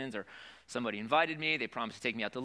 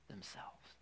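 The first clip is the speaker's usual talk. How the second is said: whisper; 17 dB quieter than usual. Acted. Scripture read fading out.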